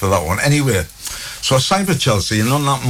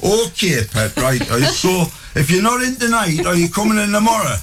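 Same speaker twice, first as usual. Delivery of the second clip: much higher, sing-song Geordie mum; an unfazed mum, still thinking of tea; mum's voice; 12 semitones higher than usual. Retold or imitated. Mum ignores the bought house, much higher sing-song voice.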